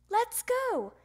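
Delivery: put-on loud, high, impatient kid; eager urging. A narrator's reading. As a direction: burst out, raring to go. Froggy eager to leave.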